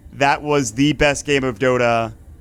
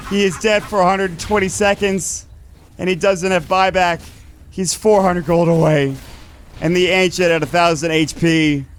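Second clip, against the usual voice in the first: play-by-play; 4 semitones higher than usual. Acted. Tense late-game casting.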